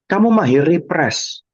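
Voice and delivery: usual speaking voice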